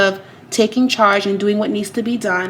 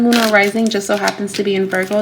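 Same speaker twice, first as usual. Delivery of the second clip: ordinary delivery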